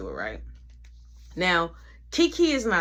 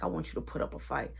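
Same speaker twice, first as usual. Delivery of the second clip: fast hushed threat; quiet, quick taunt; low menacing whisper; 6 semitones lower, 9 dB quieter, faster than usual. Retold or imitated. Rival speaking low, menacing.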